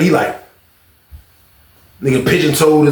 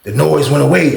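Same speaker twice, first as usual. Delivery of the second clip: usual speaking voice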